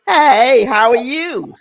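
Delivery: loud laughing greeting; laughing hello. Real, not acted. Caller laughing as she greets.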